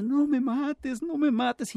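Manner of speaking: high, drawn-out mock plea; imploring. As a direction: plead slowly in a high, put-on voice. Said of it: "arrodillado le implora y dice".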